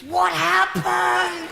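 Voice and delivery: put-on high, very slow, stretched-out child voice; drawn-out high-pitched shock; shocked little boy. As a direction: gape aloud like an astonished kid. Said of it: "this little boy came up to me".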